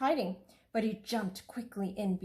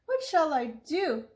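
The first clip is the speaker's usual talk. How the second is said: put-on high, worried child's voice; alarmed boy hearing the tigers; frightened little boy; 9 semitones higher than usual.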